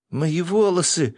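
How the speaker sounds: high, drawn-out distressed cry; horrified cry. A narrator's reading. Girl discovering her hair is gone, shouting in horror. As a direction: cry out in dismay, high and loud. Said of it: "закричала она".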